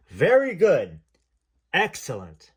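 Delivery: big, loud voice from above; booming voice. Retold or imitated. Station man praising the dancer, booming loudly. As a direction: boom it down from the sound room. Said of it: "A booming voice filled the large room".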